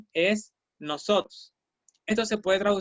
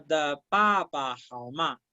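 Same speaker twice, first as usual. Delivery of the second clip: slow model phrase; 3 semitones lower than usual. Shown as a demonstration. Teacher modeling ta de papa for the class, slowly. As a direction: lay the Chinese out slowly for learners. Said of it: "Si yo digo".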